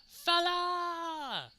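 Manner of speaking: high, ringing Irish "Fella!"; high Irish catchphrase shout; Sheamus fella call. Retold or imitated. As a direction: belt it out like Sheamus.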